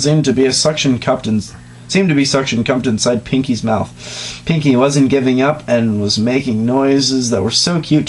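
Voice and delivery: ordinary talk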